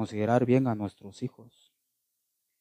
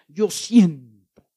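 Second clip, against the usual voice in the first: mocking excuse; 3 semitones higher, 7 dB louder, much slower than usual. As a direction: drag it out to ridicule the excuse. (burla lenta y exagerada)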